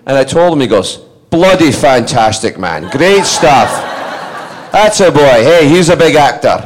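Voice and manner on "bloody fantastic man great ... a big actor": loud, drawn-out mock dad delight. Dad told how much acting pays, delighted. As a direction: crow it slowly and loudly as a proud dad.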